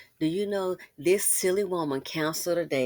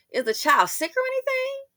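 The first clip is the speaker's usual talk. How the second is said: mock high question; 3 dB louder than usual. Asking caller with a mock-high voice.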